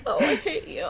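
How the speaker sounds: playful hate through giggles; laughing mock complaint. Real, not acted. To co-host after being teased, laughing complaint. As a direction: grumble fondly while laughing.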